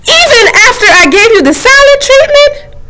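the book character shouting in dismay; outraged shout. Acted. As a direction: yell at the reader, half crying.

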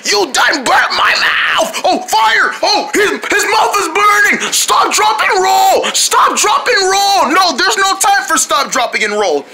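high, frantic cartoon alarm; panicked shouting. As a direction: yell like it's a fire emergency. Panic over a burning mouth.

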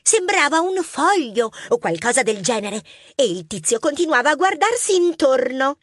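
put-on high child voice, eager retelling; little girl witness. Young mouse Mar describing the thief to police, high child voice. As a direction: tell it like a keen young witness. Mar tells what she saw.

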